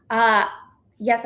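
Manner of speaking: ordinary delivery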